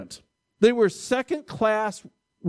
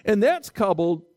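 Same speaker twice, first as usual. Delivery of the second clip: ordinary talk, nothing marked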